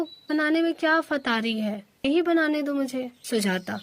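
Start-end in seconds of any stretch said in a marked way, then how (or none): none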